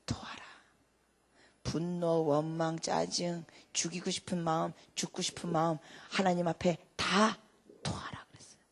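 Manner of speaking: soft, low, slow delivery; hushed preaching. Acted. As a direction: draw them in quietly. (조용히 속삭이듯)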